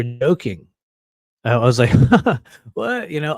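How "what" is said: high, startled yelp of disbelief; a sudden high squeak of shock; stunned what. Retold or imitated. Telling a stranger who accused him, high shocked disbelief.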